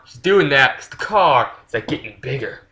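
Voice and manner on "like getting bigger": ordinary delivery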